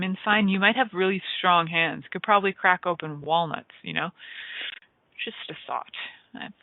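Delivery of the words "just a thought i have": breathy whispered aside; whispering. Real, not acted. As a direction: drop to a whisper. Throwaway aside after joke.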